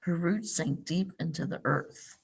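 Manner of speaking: gentle storybook read-aloud; picture-book narration for a class; reading to children. Acted. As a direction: read it like story time for little kids.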